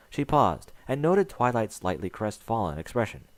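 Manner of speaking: ordinary delivery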